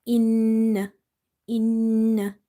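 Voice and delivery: "Inna" with a long n vibrating in the nose; nasal held nun. Shown as a demonstration. Teacher showing vibration in the nose, held nasal n. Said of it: "feel the vibration into your nose".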